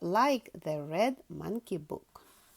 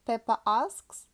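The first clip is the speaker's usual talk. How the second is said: plain reading voice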